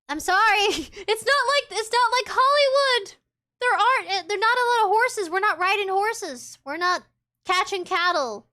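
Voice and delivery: high, loud, half-laughing protest; playful exasperation. Real, not acted. Southerner telling viewers it's not like Hollywood, playful exasperation. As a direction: protest it loudly, pitched well up.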